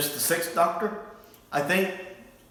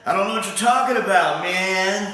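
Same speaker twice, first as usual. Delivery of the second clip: lightly imitating a stoner hippie; 5 semitones higher, 3 dB louder than usual. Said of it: "He sounds like a hippie".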